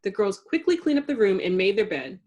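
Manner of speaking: usual speaking voice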